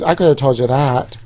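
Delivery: ordinary talk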